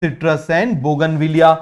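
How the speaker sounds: very slow, word by word for note taking; slow dictation. Shown as a demonstration. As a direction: spell it out slowly so students can write. Teacher dictates examples slowly.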